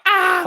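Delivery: high, loud gasp of shock; inner freak-out. Retold or imitated. Freaking out inside at the bus driver's claim. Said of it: "I'm, like, freaking out".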